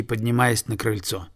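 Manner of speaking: usual speaking voice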